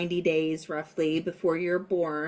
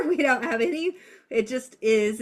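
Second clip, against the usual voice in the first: laughing; 6 semitones higher, 5 dB louder than usual. Real, not acted. Laughing while explaining traits.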